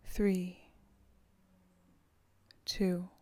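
slow soothing countdown. Acted. Counting down to relax.

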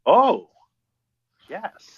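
high, loud thrilled gasp; light up with delight; excited oh yes. Real, not acted. Reacts to Chicago.

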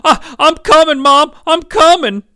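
high, loud acted wail; crying child. Glenn calling to his departing mom, loud acted crying. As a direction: cry out as the frantic boy. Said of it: "Glenn started crying too".

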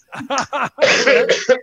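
high, breathy giggle; high laugh. Real, not acted. Laughing at the friends jumping for the camera, high giggle.

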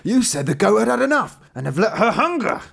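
high, loud accusing shout; angry tailor. Acted. Tailor runs upstairs to the youth, loud angry accusation. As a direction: storm at your son for lying. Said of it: "And in his anger".

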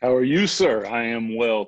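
ordinary delivery

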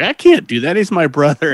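imitating a kid's pleading refusal; refusing. Sam refuses to stake brother.

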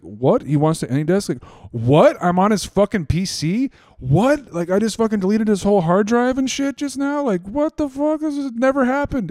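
shocked, what is happening; incredulous. Retold or imitated. Retold disbelief at scammer.

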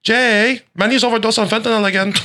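high mock-wife voice; sitcom wife impression. Wife telling grandpa about Manny, high sitcom wife impression. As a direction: report to your husband in a high put-on voice.

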